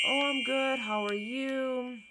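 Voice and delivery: high, drawn-out polite small talk; trying to be nice. Retold or imitated. Talking to a guy she doesn't know, put-on nice. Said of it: "I'm trying to be nice".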